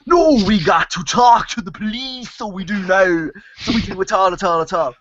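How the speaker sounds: hammed-up, over-the-top Irish accent; worst stage-Irish accent. Fed-up actor mocking the director, exaggerated stage-Irish accent. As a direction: lay the Irish accent on thick, taking the piss. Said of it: "he does the worst, most Irish accent".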